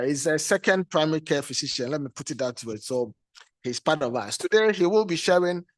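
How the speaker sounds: ordinary delivery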